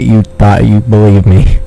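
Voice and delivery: ordinary talk, nothing marked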